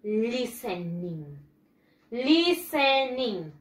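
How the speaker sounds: listening very slowly, syllable by syllable, "li-sen-ing"; correct pronunciation. Shown as a demonstration. Teacher models for students to repeat, slow "li-sen-ing". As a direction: stretch out listening with a silent T. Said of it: "And the pronunciation is".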